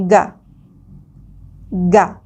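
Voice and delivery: "ga" said slowly and apart, hard G; slow school dictation. Shown as a demonstration. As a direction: drill "ga" twice with a hard G. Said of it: "then we have the G A".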